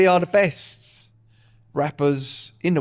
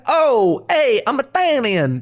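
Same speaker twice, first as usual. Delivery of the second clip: high, loud, drawn-out Italian-American caricature; mock Italian-American; 8 semitones higher, 3 dB louder, much slower than usual. Comic mocking Americans who claim to be Italian, mock Italian-American. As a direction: ham up a proud Italian-American. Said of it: "He's like".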